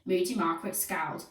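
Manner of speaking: ordinary delivery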